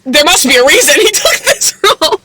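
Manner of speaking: amused, words pushed through laughter; laughing while talking. Real, not acted. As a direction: crack up mid-sentence. Cracking up over actor's casting.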